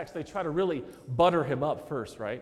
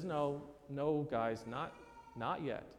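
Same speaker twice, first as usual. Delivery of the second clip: low, much softer, understated brush-off; quiet aside as Jesus; 5 semitones lower, 9 dB quieter, slower than usual. Acted. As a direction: drop low and soft, as if gently putting them off. Voicing Jesus gently putting them off.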